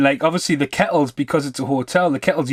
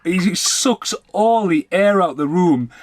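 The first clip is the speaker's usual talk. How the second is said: usual speaking voice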